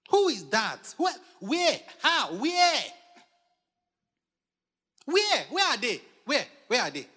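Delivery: short shouted questions, fired one by one; loud, high demands; loud challenge. Acted. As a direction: call out as if hunting for them. Preacher challenging, calling out rhetorically.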